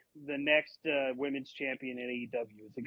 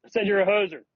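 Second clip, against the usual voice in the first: loud and clear, almost shouted; 5 semitones higher, 10 dB louder than usual. Real, not acted. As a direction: belt it out so they hear. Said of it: "Try it again".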